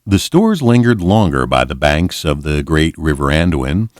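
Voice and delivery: usual speaking voice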